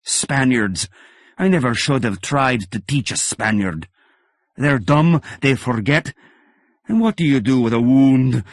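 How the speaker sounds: loud, exasperated teacher's bark; mentor yelling. Acted. Old fencing master yelling at his Spanish pupil. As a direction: berate the pupil, loud and fed up. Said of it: "McPherson was screaming again".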